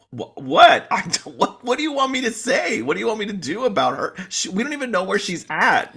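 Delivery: high, stammering mock bewilderment; dumbfounded. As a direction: act baffled at being blamed. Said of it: "She looks dumbfounded".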